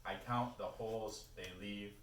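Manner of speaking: projected recital in short phrases with weighted pauses; poetry reading. Acted. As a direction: start each line lifted, let it fall away. Reads a poem aloud.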